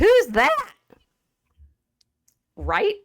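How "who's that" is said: high, incredulous mock question; a hammed-up blank "who's that?". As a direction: squeak it like a clueless listener who's never heard of him. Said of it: "And all of you all would say".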